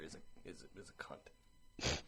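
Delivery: low, soft unvoiced whisper. Real, not acted. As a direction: whisper the rude word. Whispering the forbidden word.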